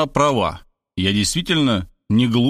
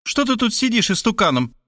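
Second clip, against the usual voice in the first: high-pitched scolding repeat of the question; insistent, pitched-up reproach; insulting nag; 10 semitones higher than usual. A narrator's reading. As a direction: nag him in a high mother's voice. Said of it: "уснастив его оскорбительными деталями".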